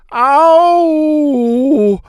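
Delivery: high, loud wailing cry; hammed-up high yelp of pain. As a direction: howl it out in mock agony. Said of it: "I cried".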